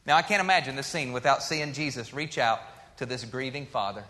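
ordinary delivery

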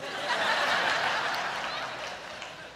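breathless airy laugh. Real, not acted. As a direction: laugh it out, almost silent. Laughing at his own story.

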